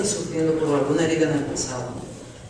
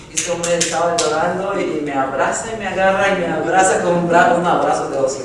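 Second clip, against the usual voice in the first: usual speaking voice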